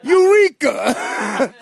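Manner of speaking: slow, loud triumphant "Eureka"; triumphant shout. Retold or imitated. Mock inmate's discovery, drawn-out triumphant shout.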